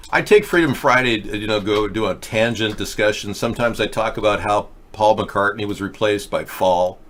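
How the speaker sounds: usual speaking voice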